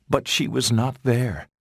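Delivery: ordinary delivery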